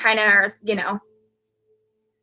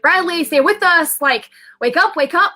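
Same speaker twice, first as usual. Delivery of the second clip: higher-pitched urgent calling, mildly raised voice; retold scream; 7 semitones higher, 4 dB louder than usual. Mother screaming for her son to stay with them, retold scream. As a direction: imitate yourself crying out, held back from a full scream. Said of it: "I'm screaming, and I'm just like".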